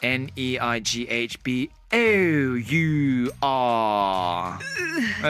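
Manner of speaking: slow, letter by letter; spelling it out. Shown as a demonstration. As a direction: spell エヌイーアイジーエイチビーオーユーアー out slowly. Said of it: "ご近所さんってなんて言いますか".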